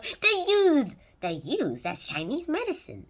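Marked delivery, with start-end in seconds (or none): 0.0-0.9 put-on high, loud jokey Chinese accent; a bad put-on Chinese accent; badly faked Chinese accent; mock Chinese accent. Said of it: "worst Chinese accent". 1.8-3.1 a slightly put-on Chinese accent; self-mocking Chinese accent. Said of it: "worst Chinese accent".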